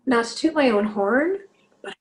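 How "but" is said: whispered. Real, not acted. Proud confession, whispered.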